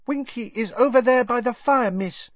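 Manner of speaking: very high-pitched little elf voice; high, small elf voice, a little subdued; squeaky elf answering politely; subdued house elf. A narrator's reading. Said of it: "said Dobby quietly".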